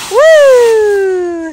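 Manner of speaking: high, loud whoop of delight; excited cheer. Real, not acted. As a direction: cheer the jump like a fan. Cheering kids jumping in leaves.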